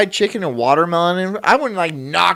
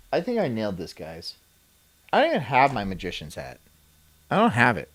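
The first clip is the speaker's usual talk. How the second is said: ordinary talk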